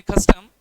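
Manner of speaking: Custom with कस loud and prominent; stress on first syllable. Shown as a demonstration. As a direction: lean on कस in custom, drop टम. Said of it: "कस की sound ज़्यादा रहती है".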